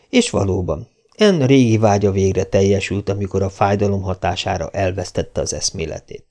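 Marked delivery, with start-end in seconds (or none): none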